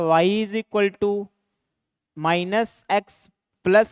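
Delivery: each word of the equation spelled out slowly; dictating slowly so the class can write it down; slow dictation. Shown as a demonstration. Teacher dictating to students, slow and deliberate.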